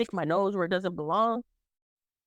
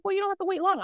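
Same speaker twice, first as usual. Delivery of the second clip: high sassy manager; 11 semitones higher, faster than usual. Retold or imitated. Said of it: "She's like".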